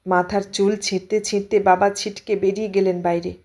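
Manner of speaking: plain reading voice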